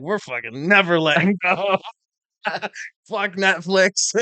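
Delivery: loud, high mock defiance; hammed-up rebellious shout; defiant holdout. Acting out defiant Blockbuster holdouts.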